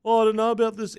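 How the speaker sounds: high, smug commentator voice; snooty commentator impression; mocking Blocker Roach. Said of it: "basically going".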